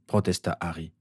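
usual speaking voice

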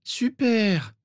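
high, drawn-out sulky grumble; grumbling Ginny. A narrator's reading. Girl hears school is starting again, grumbling. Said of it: "maugréa Ginny".

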